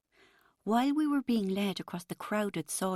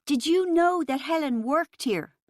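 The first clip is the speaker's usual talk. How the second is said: louder, higher roared repeat; roaring; 9 semitones higher, 7 dB louder than usual. A narrator's reading. Rachel roaring her question at Chris over the music, angry roar. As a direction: let the anger out in a roar. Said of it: "I roared".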